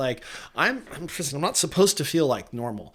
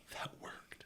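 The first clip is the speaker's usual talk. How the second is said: "That worked" whispered under the breath; 23 dB quieter than usual. Retold or imitated. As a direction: breathe it out to yourself, no voice. Quiet aside to himself.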